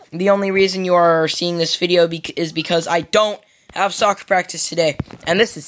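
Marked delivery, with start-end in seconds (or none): none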